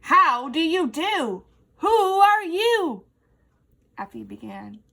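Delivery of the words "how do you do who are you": loud, high booming greeting. Acted. Effie greets the spider, booming loudly. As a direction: boom it so the web shakes. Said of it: "Her voice shook a web".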